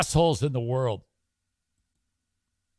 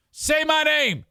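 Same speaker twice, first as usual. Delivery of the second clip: high shout-like demand; 11 semitones higher than usual. Shown as a demonstration. Host demanding listeners say his name, high. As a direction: Say my name pitched way up.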